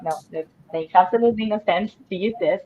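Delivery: ordinary delivery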